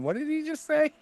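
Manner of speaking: high, confused "what did he say"; puzzled, lost listener; baffled listener. Pretend. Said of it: "someone trying to listen to it and going".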